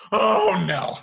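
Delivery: loud, high cry of dread; acted panic at what's coming; alarmed castle staff. Staff seeing Beast lose his temper, alarmed. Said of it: "this is like".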